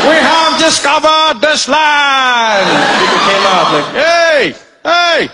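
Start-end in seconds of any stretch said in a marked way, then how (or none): 0.1-2.7 slow, high, loud grand proclamation; pompous high proclamation, very slow; pompous colonizer. Acted. 4.0-5.2 high, loud hey hey; loud high calling. Acted. Locals calling out.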